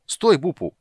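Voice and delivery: high, loud exclamation; calling out. A narrator's reading. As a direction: call after someone to stop. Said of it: "воскликнул он".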